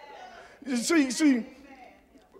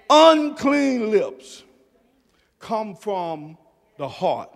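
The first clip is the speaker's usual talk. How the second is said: normal talking voice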